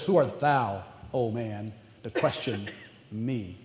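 low, grave and sinking voice; booming God. Pretend. God rebuking questioning Job, low and grave. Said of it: "God looks at Job and says".

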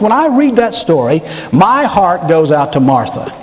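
normal talking voice

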